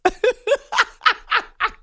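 a real laugh jumping way up in pitch; high laugh. Real, not acted. Host reading Justin's bracket confession, high laugh. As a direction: let a high giggle escape.

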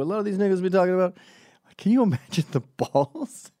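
lightly put-on voice, high, loud and fast; mock hip-hop talk. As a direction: rattle it off loudly as a mock quote.

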